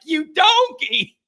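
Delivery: high, drawn-out mock yell in a British accent; Gordon Ramsay. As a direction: imitate an angry British chef's accent, stretching it out. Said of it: "he did it with that accent".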